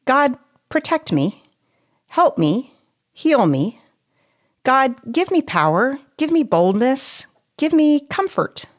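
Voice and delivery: lightly voiced pleading prayer; mock pleading prayer. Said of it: "it's often".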